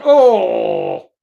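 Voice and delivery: loud, long "oh!" of reaction; loud drawn-out cry. Real, not acted. Fan reacting to the match, loud drawn-out cry. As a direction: cry out a big, sustained "oh".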